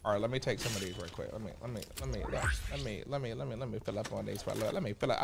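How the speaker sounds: quiet, mumbled through food; mouth full. Real, not acted. Streamer mumbles to chat while eating.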